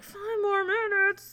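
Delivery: high, drawn-out wail of disbelief; dismayed echo. Retold or imitated. Patient told to wait with a full bladder, dismayed. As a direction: stretch it out high in mock dismay.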